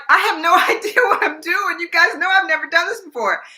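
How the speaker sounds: high-pitched retold panic with a laugh; helpless and half laughing, pitch way up; flustered protest. New reporter told she is the top story, flustered. Retells protesting to producer, amused.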